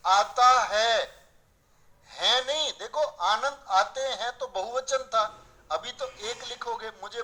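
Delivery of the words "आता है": आता है drawn out slowly and punched loud; slow loud stress on आता है; emphatic correction. Shown as a demonstration. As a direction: lean hard on आता है as the right form. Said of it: "या आनंद आता है".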